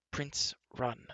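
soft cautionary use of the name; warning. A narrator's reading. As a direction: warn him quietly. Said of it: "Terran warned".

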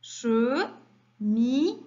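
"Cheminée" very slow, che... mi... née; syllable by syllable. Shown as a demonstration. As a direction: pause after each syllable of "Cheminée". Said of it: "combien y a-t-il de syllabes". (« Cheminée » lentement découpé, che... mi... née)

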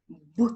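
Б as a short, loud consonant sound; isolated sound. Shown as a demonstration. Teacher giving the first sound, Б pronounced in isolation. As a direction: punch out Б alone, not the letter name. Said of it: "Перший звук який?".

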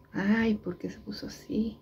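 slow whispered worry; hushed, slow fretting aside. Real, not acted. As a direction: whisper it to yourself, puzzled. Whispering worried to herself.

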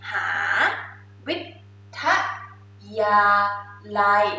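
มหาวิทยาลัย split into ม, หา, วิ, ท, ยา, ลัย with a pause between each; teacher breaking a long word into parts; syllable by syllable. Shown as a demonstration.

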